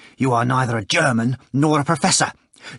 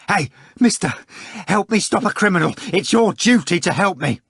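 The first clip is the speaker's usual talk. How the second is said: higher, louder urgent call for help; urgent appeal; 9 semitones higher, 4 dB louder than usual. A narrator's reading. Ivan calls for help.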